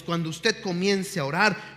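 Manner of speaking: normal talking voice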